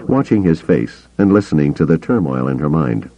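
usual speaking voice

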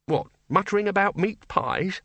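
high-pitched querying voice; incredulous bursar. A narrator's reading. Bursar questioning the Dean's wish, incredulous. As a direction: lift it high in mild disbelief.

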